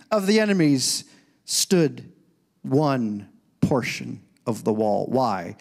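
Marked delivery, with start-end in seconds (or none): none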